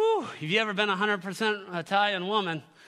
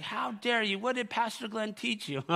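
mock indignation; 5 semitones higher than usual. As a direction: imitate a scandalized mother lightly. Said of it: "She was fired up, but she wasn't excited".

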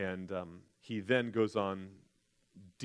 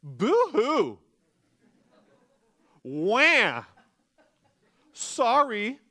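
high, loud mock blubbering, hammed-up; mocking fake crying; 15 dB louder, much slower than usual. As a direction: sneer at his pain with fake sobs. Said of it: "They essentially say this to him".

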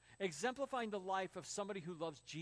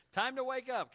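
parent's wake-up call; 6 semitones higher, 7 dB louder than usual. Acted. Parent waking a sleeping teenager.

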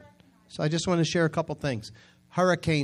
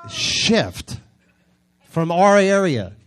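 higher and louder, a preacher's command; shouted command; 6 semitones higher, 9 dB louder, much slower than usual. Real, not acted. Preacher commanding the storm, shouted. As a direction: belt it out like a command. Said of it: "preaching emphasis".

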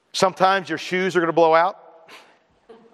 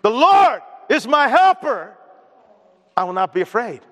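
defiant declaration; 4 semitones higher, 3 dB louder than usual. Shown as a demonstration. Preacher stares down a boxing opponent, defiant declaration. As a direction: belt out the first half, then settle it firmly. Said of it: "that's how you stare Clubber Lang down".

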